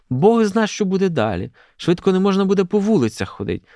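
high, fretful matron complaint; alarmed lament. A narrator's reading. Marta fearing what comes next on the streets, lamenting.

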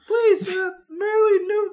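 high, loud hammy sob; mock-weep the plea; fake crying plea. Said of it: "you cry".

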